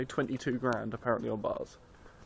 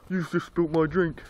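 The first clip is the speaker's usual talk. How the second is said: mock aggrieved punter; 5 semitones higher, 5 dB louder, slower than usual. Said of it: "He's like".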